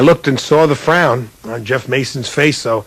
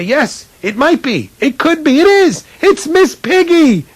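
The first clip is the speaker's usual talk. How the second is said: climbing higher and louder, slow grand reveal; building excitement; 4 dB louder than usual. Retold or imitated. Rookie analyst greeting viewers on air, building to excitement. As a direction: start unsure, build to a big announcement.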